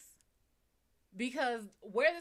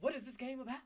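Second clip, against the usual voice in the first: mocking streamer video voice, booming greeting then sing-song; Markiplier; 4 dB louder than usual. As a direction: overdo a put-on video host intro. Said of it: "That's how he sounds".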